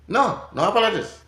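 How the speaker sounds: high, loud refusal; no apologies, said loud and high; firm no. Retold or imitated. Mama shouting no apologies at her son.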